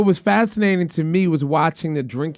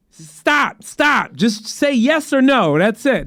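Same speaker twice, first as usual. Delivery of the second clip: higher, insistent cut-off; exasperated caller; 4 semitones higher, much slower than usual. Retold or imitated. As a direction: stop the agent with fed-up emphasis. Retold caller cutting agent off.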